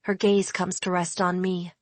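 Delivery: usual speaking voice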